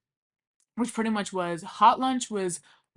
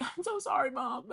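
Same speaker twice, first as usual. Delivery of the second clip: pretend apology; 5 semitones higher, 5 dB quieter than usual. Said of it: "pretend that my mom would pick up".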